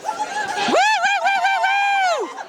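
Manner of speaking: very high whooping cheers; excited cheering whoops. Real, not acted. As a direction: whoop like a fired-up crowd. Cheering at a rally.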